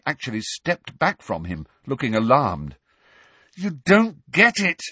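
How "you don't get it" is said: loud, high and slow angry shout. A narrator's reading. Harry shouting at Hermione, loud and slow. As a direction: yell it at her in frustration. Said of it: "Harry shouted at her".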